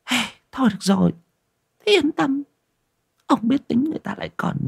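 high, quick careless voice; brash wife. Acted. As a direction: toss it off carelessly. Wife answering husband.

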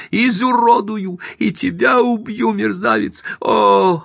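very high frenzied threats; furious shriek. A narrator's reading. Wife threatens husband and rival, shrieking. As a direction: screech threats in wild rage. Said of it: "взвизгнула Эйлин".

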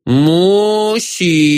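put-on plaintive childlike call, stretched long; drawn-out toddler call. A narrator's reading. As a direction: draw it out like a little kid calling. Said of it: "карапуза протянул".

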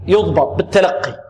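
ordinary talk

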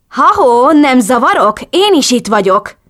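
high, loud, pointed hello; indignant calling out. A narrator's reading. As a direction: butt in loudly, demanding attention. Captive indignantly demands attention. (sértődötten, hangosan közbekiáltva)

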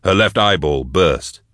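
usual speaking voice

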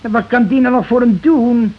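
high, loud and exasperated; wife keeps pushing for the doctor, so he explodes in exasperation. Acted. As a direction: throw the question at her in disbelief. Exasperated husband in argument.

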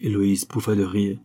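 ordinary delivery, nothing marked

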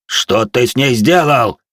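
desperate shout. Acted. Dwarf finds girl burning.

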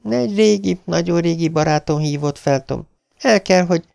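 high, urgent woman's voice; wife pleading with husband, urgent; wife's plea. Acted. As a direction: explain quickly before he cuts in. Beverly explains.

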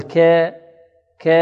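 "ك" ممالة نحو الكسرة; أمل فتحة "ك" إلى الياء غلطًا; إمالة الكاف. Shown as a demonstration. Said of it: "هذا تقليل أو إمالة". (انطق "ك" مثل "كِي" مخففة)

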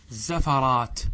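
زفرات as "zafaraat", ف taking the a of ز; careful زفرات with short a after ف; vowel copied to second letter. Shown as a demonstration. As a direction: say زفرات slowly as "za-fa-raat". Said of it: "يجب فيه الإتباع".

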